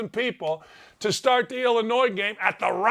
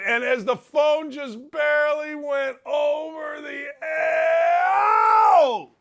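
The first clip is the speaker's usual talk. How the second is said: rising panicked yell, much higher; mounting alarm; 6 semitones higher, slower than usual. Retold or imitated. Narrating the phone slipping off the edge, rising yell. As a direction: build to a cry as it tips over.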